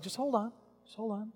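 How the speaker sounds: soft, unhurried "wait a moment"; calm hushing. Acted. Jesus to a desperate father, calm and soft. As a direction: hush a worried person gently.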